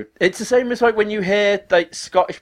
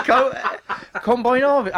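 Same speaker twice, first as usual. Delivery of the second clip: higher, struggling Australian attempt; failed Australian accent; 9 semitones higher than usual. Shown as a demonstration. Retrying on request and failing, high mock Australian. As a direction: have another go at Aussie, badly. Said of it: "I can't do it".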